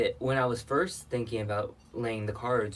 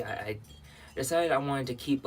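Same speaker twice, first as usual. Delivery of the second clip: ordinary talk, nothing marked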